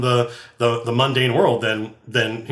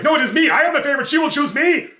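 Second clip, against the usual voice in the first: insane Darrow leader; 11 semitones higher, faster than usual. Retold or imitated. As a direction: claim the favour like a spoiled zealot. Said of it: "the leader's like".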